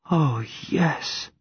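high, soft, tearful voice; mild tearful overwhelm; on the verge of tears. A narrator's reading. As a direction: answer softly and high, about to cry. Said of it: "she says and starts to cry".

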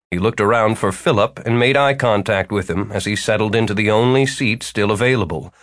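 plain reading voice

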